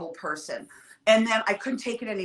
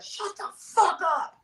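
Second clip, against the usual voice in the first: high, slow angry shout at the room; angry retort; 10 semitones higher than usual. Retold or imitated. Robert Downey shouting down a chatty room, angry shout. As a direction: bark it at the whole room, slowly. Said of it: "He's like".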